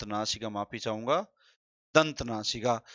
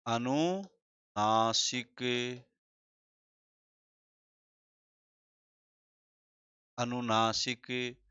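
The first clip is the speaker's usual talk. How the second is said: slow spelled-out term; much slower than usual. Shown as a demonstration. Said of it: "अगला है हमारा अनुनासिक".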